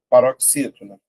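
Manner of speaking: ordinary talk, nothing marked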